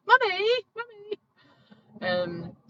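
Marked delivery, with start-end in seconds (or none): 0.1-1.2 put-on high, loud child calling Mummy; shrill-free but very high kid's call; imitating small child. Child calling for mum mid-phone call, high and loud.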